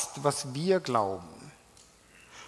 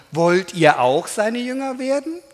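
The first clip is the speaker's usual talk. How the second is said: mocking; 5 semitones higher, 7 dB louder than usual. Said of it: "sie auch noch zu verhöhnen".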